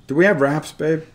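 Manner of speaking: loud call across the house. Real, not acted. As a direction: raise the voice to reach her. Calls to wife in another room.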